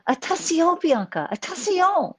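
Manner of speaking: put-on high, loud French-accented warning; hammed-up French cry of alarm; terrible French accent. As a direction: imitate a French friend calling out, badly accented. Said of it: "bear with my terrible accent".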